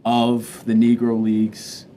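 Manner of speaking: ordinary delivery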